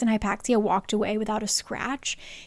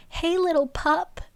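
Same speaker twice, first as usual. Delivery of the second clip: a light, higher sing-song hey little pup; teasing greeting; 6 semitones higher than usual. Retold or imitated. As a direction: tease him like a big brother. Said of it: "they are like".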